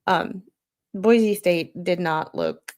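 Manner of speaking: usual speaking voice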